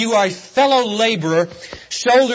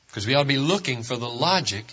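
normal talking voice

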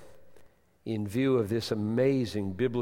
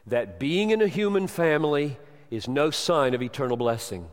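ordinary delivery

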